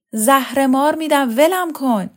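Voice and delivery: loud, high, irritated retort; exasperated snap. A narrator's reading. Mother to nagging daughter, exasperated snap. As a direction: snap back to be left alone.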